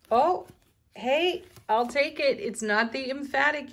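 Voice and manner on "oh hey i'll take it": much higher, louder happy exclamation; a bright burst of surprise at a good card; delighted surprise. Real, not acted. Tarot reader cheering a yes card, excitedly higher and louder.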